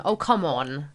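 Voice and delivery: ordinary delivery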